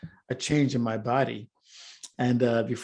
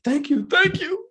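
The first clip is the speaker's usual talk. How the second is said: relieved thanks; 6 dB louder than usual. Retold or imitated. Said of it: "I said".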